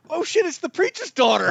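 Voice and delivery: reenacted shock, pitched high; astonished realization. Viewer realizing who the killer is, astonished outburst. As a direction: blurt it out high, stunned by the twist.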